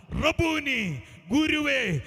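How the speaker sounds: high, loud, long-drawn call; cry from within. Retold or imitated. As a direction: cry out to the teacher, stretching each word. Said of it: "അവളുടെ ഹൃദയത്തിൽ നിന്ന് വന്ന ഒരു പദമാ". (ഉള്ളിൽ നിന്നുള്ള നീട്ടിയ ഉറക്കെ വിളി)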